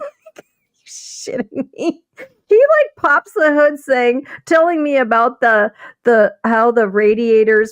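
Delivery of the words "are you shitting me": slow, hushed "are you kidding me" thought; inner disbelief. Retold or imitated. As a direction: drop to a slow, much softer disbelieving mutter under the breath. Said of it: "I was like".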